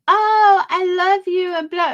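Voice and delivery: high squealy sarcastic gush with a put-on cry; mock fake gush. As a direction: mimic her sugary, fake affection. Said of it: "she was just so fake yesterday".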